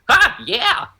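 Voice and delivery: very high manic yelp; gleeful squeaky ha, yeah; Comedy mask. Acted. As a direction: squeal it like an over-jolly mascot. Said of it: "I can do both voices".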